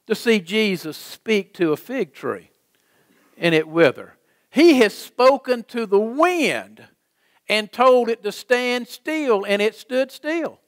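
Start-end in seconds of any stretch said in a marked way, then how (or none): 4.5-10.6 high-pitched, emphatic preacher's proclamation; preaching. Real, not acted. Preacher telling how he spoke to the wind, preaching. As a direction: proclaim it with ringing conviction.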